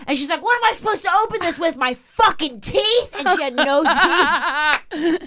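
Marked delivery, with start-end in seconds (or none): 0.4-3.1 high, incredulous angry demand; acted-out outraged stranger; indignant rant. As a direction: start fast, then drag out the last words. Woman in story keeps ranting.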